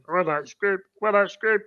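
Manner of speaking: a hint of the man in a wheelchair; mildly put-on sketch-comedy voice; lightly voiced Little Britain character. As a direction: lightly imitate a simple-minded demand. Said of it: "And he acts like he's".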